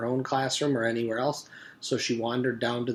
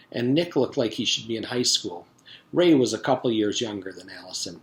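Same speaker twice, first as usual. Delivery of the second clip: lively read-aloud storytelling voice; story narration; 5 dB louder than usual. A narrator's reading. Teacher reading a chapter to the class, lively storytelling.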